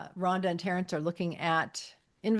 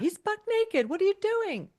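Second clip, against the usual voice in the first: much higher mock-horrified squeal; horrified client. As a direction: shriek it like a scandalised client in a falsetto.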